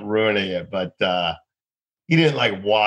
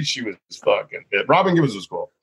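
usual speaking voice

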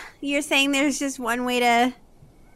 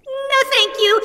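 high, airy fairy voice; 12 semitones higher than usual. Acted. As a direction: chirp it like a prim fairy. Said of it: "you hear a fairy's voice say".

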